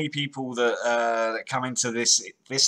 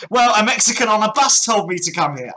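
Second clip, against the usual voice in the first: loud, high mock protest; mock excuse-maker; 8 semitones higher, 8 dB louder than usual. Host imitating a caught man switching stories, mock indignant excuse. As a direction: blurt out an absurd alibi in imitation. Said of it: "It's like".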